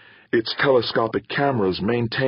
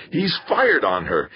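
higher-pitched, contained shout; 7 semitones higher than usual. A narrator's reading. Victor seeing the ship fire, shouted. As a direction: cry out in alarm without full volume. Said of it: "Victor shouted".

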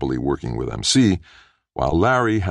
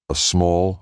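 plain reading voice

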